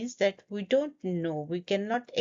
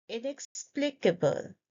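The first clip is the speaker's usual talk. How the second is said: ordinary delivery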